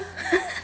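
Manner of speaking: bursting into a high giggle; high burst of laughter. Real, not acted. Laughing at her sunburn line, a high giggle.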